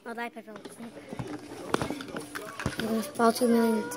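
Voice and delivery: normal talking voice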